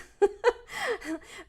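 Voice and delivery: bursting into high, loud laughter; a high, real laugh that breaks out mid-sentence. Real, not acted. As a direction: crack up at the viewer complaints. Laughs at viewer complaints.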